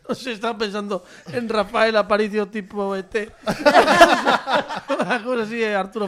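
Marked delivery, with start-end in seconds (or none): 3.3-4.9 loud laugh. Real, not acted. Joke about Rafael Aparicio.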